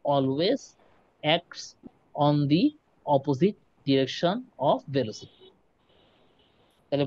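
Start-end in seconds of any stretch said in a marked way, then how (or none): 0.0-5.5 slow word-by-word dictation. Shown as a demonstration. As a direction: spell it out slowly for notes. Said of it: "এটাও তুমি চাইলে note down করে রাখতে পারো".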